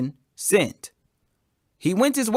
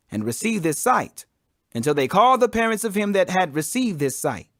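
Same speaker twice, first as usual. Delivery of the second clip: usual speaking voice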